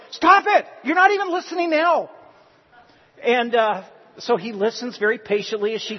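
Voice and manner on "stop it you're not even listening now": high, loud, furious cut-off; exasperated shout. Retold or imitated. Wife cutting off husband mid-sentence, furious shout. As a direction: shout him down, much louder.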